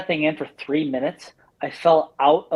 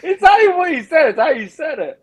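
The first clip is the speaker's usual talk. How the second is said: high, squealing through laughter; laughing hard; 12 semitones higher, 4 dB louder than usual. Real, not acted. Teasing a friend over his delivery, laughing hard. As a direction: barely get it out for laughing.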